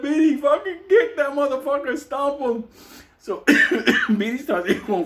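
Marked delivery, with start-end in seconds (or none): 0.0-2.6 high, excited yell through laughter; urging him on. Retold or imitated. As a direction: egg on a fighter while cracking up. Urging Beatty to stomp him.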